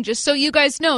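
ordinary talk, nothing marked

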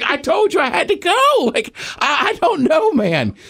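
high-pitched, exasperated self-defence; hammed-up flustered excuse, squeaking high; mock defensive protest. Acts out his defensive retort.

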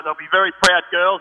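ordinary talk

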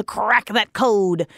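gruff pirate drawl on crack that code; accidental pirate accent. Real, not acted. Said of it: "why did I sound like a pirate".

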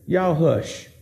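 low, soft, casual "Y'all hush"; calm him down like a parent; Jesus calmly hushing. Acted. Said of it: "I can just see him say".

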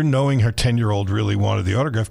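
ordinary talk, nothing marked

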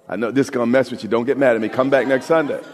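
ordinary talk, nothing marked

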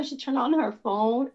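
ordinary talk

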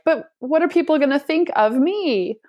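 high, fretful self-doubt; anxious worry. Retold or imitated. A musician frets about what people think, high and anxious. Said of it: "it's super scary when we're like".

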